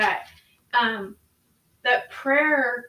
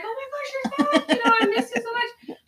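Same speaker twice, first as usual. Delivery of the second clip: much higher, squealing puppy-happy voice; excited dog voice; 12 semitones higher than usual. Retold or imitated. Voicing the dog greeting its returning owner, high and thrilled. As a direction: gush like a dog whose owner just walked in. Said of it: "but they're like".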